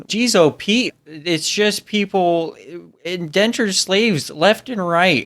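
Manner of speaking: mock Minnesota lilt; Minnesota accent. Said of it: "we're somewhere in Minnesota".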